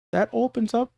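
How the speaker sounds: high-pitched bad Australian accent; attempted Australian accent. Streamer trying to talk like an Australian player, badly faked Australian accent. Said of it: "why does my voice sound like that".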